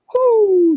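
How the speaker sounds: high hooting like an owl; owl hoots. Retold or imitated. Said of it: "they start hearing this".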